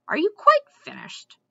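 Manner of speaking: high, loud, testy squirrel voice; disapproving squirrel. A narrator's reading. Squirrel in a tree to Mendenbar below, disapprovingly. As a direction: demand it with pointed disapproval. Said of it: "staring down at him in disapproval".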